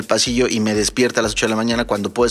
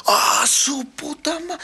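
chillido agudo y lento de susto; grito de susto; much slower than usual. Retold or imitated. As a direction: se le sube la voz del espanto, alargando. Susto al oír que no hay nadie. (chillido asustado y agudo al oír que no hay nadie)

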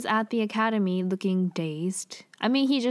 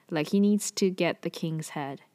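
normal talking voice

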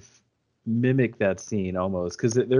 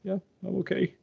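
much higher, choked-up imitation of holding back tears; imitating teary reply; 11 semitones higher, 3 dB quieter, faster than usual. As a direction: mimic him fighting tears as he answers. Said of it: "And he starts tearing up".

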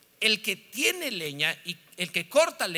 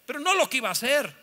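normal talking voice

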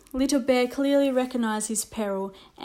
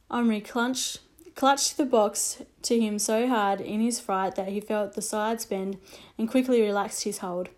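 ordinary delivery, nothing marked

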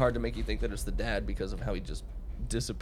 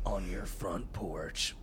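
slow, softer, lower menace; slow low threat; 3 semitones lower, 6 dB quieter, much slower than usual. Retold or imitated. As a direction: draw it out quietly. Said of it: "And he says".

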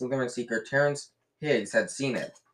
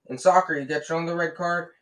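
furious; 6 semitones higher, 4 dB louder than usual. A narrator's reading. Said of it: "said Dean furiously".

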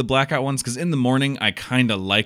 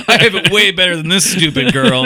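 higher, louder gloat through laughter; laughing gloat; 8 semitones higher, 8 dB louder than usual. Pretend. Co-host plays a gloating kid, high loud laughing brag. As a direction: brag cruelly while cracking up.

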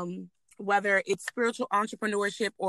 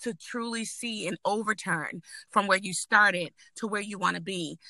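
normal talking voice

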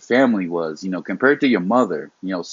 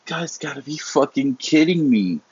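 higher, incredulous outburst; can't believe what he's hearing; mock disbelief, pitched up; exasperated disbelief; 5 semitones higher than usual. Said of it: "I'm like".

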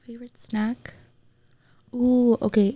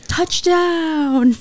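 sports commentator; 6 semitones higher, 7 dB louder than usual. Pretend.

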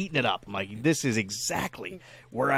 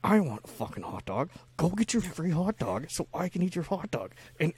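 got quiet; 4 semitones higher, 6 dB quieter than usual. Retold or imitated. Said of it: "I, I got quiet".

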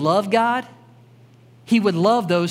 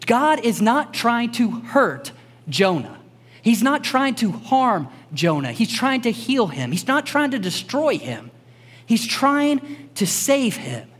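slower, earnest preaching build; 3 semitones higher, slower than usual. Real, not acted. Preacher telling the congregation God heals, earnest build. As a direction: press the contrast home slowly. Preaching about God's intent.

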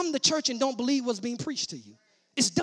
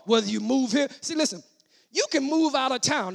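ordinary talk, nothing marked

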